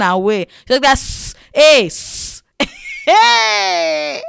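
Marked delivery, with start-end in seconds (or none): 1.0-2.4 calling hiss. Retold or imitated. Seller hissing to call her back. 3.0-4.2 sharp high call across the shop; calling shout. Retold or imitated. Seller calling out after her.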